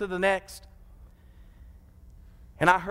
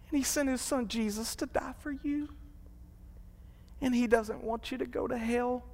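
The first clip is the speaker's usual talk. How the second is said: tender plea; 5 semitones higher, 7 dB quieter than usual. Retold or imitated. Pleading softly with dying man.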